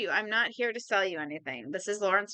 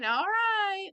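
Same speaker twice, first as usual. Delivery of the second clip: giving in; 11 semitones higher, 3 dB louder than usual. Acted. Izzy giving in to Larry.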